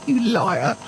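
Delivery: high, wheezing through real laughter; helpless, voiceless laughing disbelief; laughing accusation. Real, not acted. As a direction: barely get the words out for laughing. Laughing at an obvious fib.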